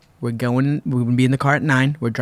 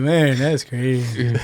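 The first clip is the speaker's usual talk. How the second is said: laid-back drawl; much slower than usual. Retold or imitated. Johnny voiced, chilled.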